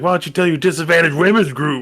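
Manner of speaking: mimicking a character whose voice suddenly changes; snapping lady. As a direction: let the voice turn nasty mid-line. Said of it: "her voice changes a little".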